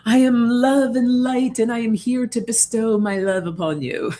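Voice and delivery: grandly bestowing love, hammed up; mock lofty spiritual guru. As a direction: ham up a self-important love-and-light guru. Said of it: "You don't have to be like".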